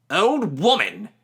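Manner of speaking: high, loud indignant echo; old woman offended at being called old; snapped. A narrator's reading. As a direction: throw the words back sharply. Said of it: "she snapped".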